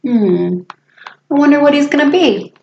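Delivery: ordinary delivery, nothing marked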